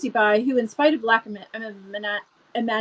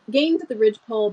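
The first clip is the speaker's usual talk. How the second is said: ordinary delivery, nothing marked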